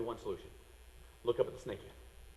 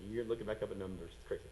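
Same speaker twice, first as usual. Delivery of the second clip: usual speaking voice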